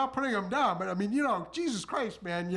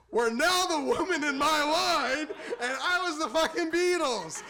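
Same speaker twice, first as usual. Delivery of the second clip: high, climactic proclamation; exultant boast; 7 semitones higher than usual. Real, not acted. Comic claiming Beatles fame at the ice cream window, exultant. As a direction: crow it to the whole room.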